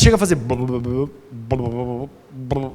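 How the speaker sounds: low, soft "blu blu blu" gurgle; gulping sound. Shown as a demonstration. Preacher showing the congregation lukewarm water going down, low soft gurgle. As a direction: imitate water glugging down, low and quiet.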